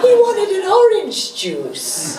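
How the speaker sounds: lightly voiced mother. Mother voiced, casual reply.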